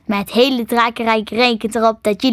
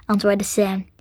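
ordinary delivery

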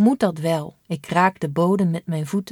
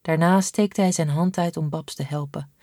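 usual speaking voice

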